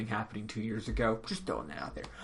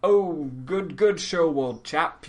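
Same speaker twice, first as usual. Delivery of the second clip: put-on loud, slow, hearty old-chap voice; Gatsby; 5 semitones higher, 8 dB louder, slower than usual. Host imitating the genial police chief, hearty old-chap impression. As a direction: draw it out in a posh British old-chap manner. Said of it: "like Gatsby from Great Gatsby".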